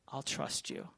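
a hushed private vow; whispered trust. Retold or imitated. Man whispers his surrender to God, hushed. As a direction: breathe "I'll trust you" as a soft whisper.

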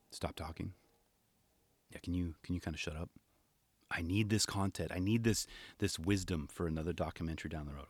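soft, hushed mock plea, as if holding back tears. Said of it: "kinda whispers to Rodney".

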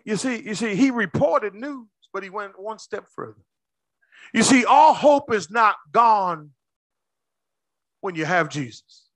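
ordinary talk, nothing marked

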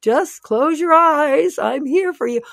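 an exaggerated heavenly voice soothing her; voice of God. God comforting her, slow and high-pitched. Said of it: "God's like".

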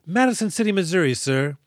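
high, eager reply to a superior; happy soldier. Acted. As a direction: chirp it proudly like a keen recruit. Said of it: "the soldier very happily responds".

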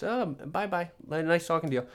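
a hint of a sweet, dainty farewell; sweet bye-bye. Shown as a demonstration. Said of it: "it's just".

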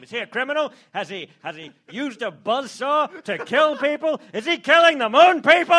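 escalating sensational outrage; tabloid presenter. Pretend. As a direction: build to an over-the-top tabloid alarm. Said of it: "tabloid role requested, line 124".

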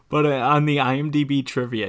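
ordinary delivery

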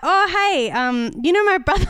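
awkward over-eager greeting. Retold or imitated. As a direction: blurt it out too brightly. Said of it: "And then I was like".

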